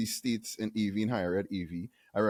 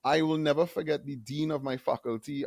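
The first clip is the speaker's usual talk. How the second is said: ordinary delivery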